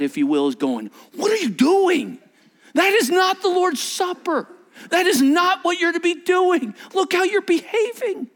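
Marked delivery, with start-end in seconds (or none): 1.2-8.3 put-on high, exasperated father scolding his kids; frustrated spiritual dad. Paul as a frustrated dad scolding the church, exasperated. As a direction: scold them like a fed-up dad. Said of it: "like a frustrated spiritual dad".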